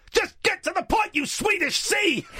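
shrieking high comic yell; high shout. Retold or imitated. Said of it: "and then he says".

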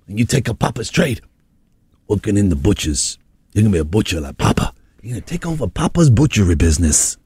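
put-on low-pitched doting parent of a New York baby; low, proud parent raising a little butcher. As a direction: croon low to your future butcher boy.

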